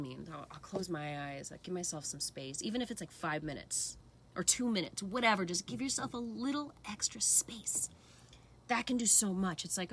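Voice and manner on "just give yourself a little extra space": gentle whisper. Real, not acted. Soft guiding advice.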